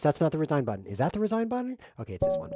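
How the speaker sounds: ordinary talk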